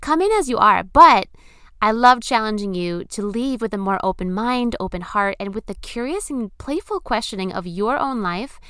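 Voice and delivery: loud, upbeat host read over music; podcast intro. Acted. Host opening her podcast for listeners, loud upbeat announcer read. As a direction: pump up the listeners like a show opener.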